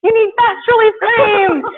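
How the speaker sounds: high, loud sing-song voice to a cow; high mock sweet-talk to livestock. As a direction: coo at the cow like it's a pet. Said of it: "we're like".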